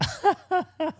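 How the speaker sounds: high-pitched bursts of laughter; high helpless laugh. Real, not acted. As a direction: laugh guiltily, pitched right up. Laughs recalling mounted badger.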